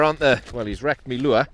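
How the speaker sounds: ordinary delivery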